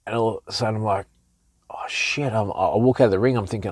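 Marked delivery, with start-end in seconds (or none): 1.7-2.3 a hushed, breathless oh-no whisper; panicked whisper. Retold or imitated. Boxer realising he fought badly, panicked whisper. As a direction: whisper it as the dread hits you. Sudden realisation of losing fight.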